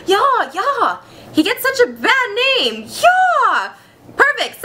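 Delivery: high, mock-German gushing; excited German accent. Flattered playwright agreeing to sign, excited German accent. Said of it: "that's my German accent for this".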